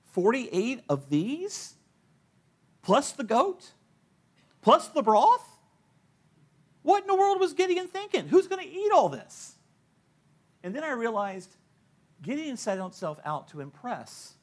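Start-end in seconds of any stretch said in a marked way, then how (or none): 0.1-9.5 building from slow disbelief to high loud shock; each question higher, ending in astonished outcry; incredulous. Retold or imitated. Pastor at the scale, amazed at forty-eight loaves. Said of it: "And I went".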